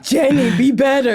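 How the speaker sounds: lightly hammed-up drunken pleading; drunken Forrest Gump viewer. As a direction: plead half-heartedly with the film, as if drunk. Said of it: "I'm watching Forrest Gump like this".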